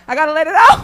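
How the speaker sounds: said out loud and firmly; loud declaration. Acted. Comic declares he must vent.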